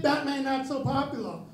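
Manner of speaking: normal talking voice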